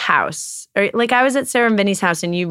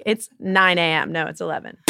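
usual speaking voice

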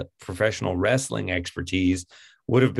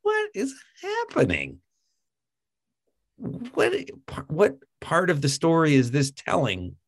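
much higher, incredulous question; bewildered disbelief; 6 semitones higher than usual. Retold or imitated. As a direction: ask as if utterly lost in the scene. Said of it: "I'm like".

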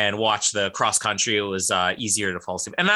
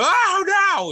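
very high, loud mock yelp; imitated commentator cry; 5 dB louder than usual. Retelling the animated bobsled commentator's very high cry. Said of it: "the same guy who had the".

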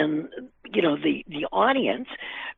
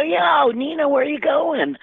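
friendly cop call; 10 semitones higher than usual. Retold or imitated. Cops calling from the car.

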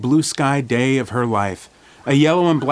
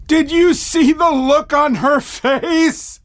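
put-on loud, slow old man voice belting; hard-of-hearing old man; 5 dB louder, slower than usual. A narrator's reading. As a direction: belt it like a deaf old man. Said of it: "belted in his hard-of-hearing old man voice".

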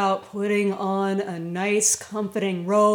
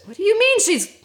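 shocked disbelief; 11 semitones higher, 6 dB louder, faster than usual. Retold or imitated. Husband hears the girl vanished.

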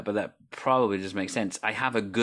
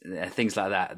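ordinary talk

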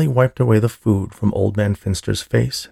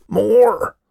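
high, loud scream for more; 13 semitones higher, 5 dB louder than usual. A narrator's reading. Old man craving more custard, screaming. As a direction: scream it out in an old man's greedy demand. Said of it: "Old Man Finster screamed".

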